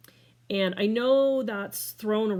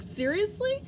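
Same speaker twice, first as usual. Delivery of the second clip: much higher, mock-startled question; incredulous; 12 semitones higher than usual. Retelling her reply to a friend's diagnosis, incredulous. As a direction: question it in high-pitched disbelief.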